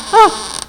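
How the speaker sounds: sharp frightened gasp-cry; startled cry. Acted. Frightened woman alone hearing the door, startled cry.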